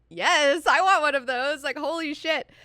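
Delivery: loud, high burst of eager delight; excited. Retold or imitated. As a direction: blurt it out with thrilled enthusiasm. Acting out own eager reaction.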